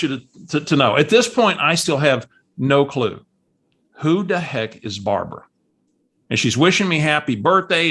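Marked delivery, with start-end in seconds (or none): none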